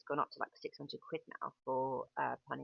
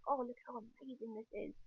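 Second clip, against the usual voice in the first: lightly voiced, higher and softer gushing architect; mock architect; 9 semitones higher, 6 dB quieter than usual. Mimicking the architect showing off a design, higher and softer. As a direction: gush over the glass like a smug designer. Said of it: "and go".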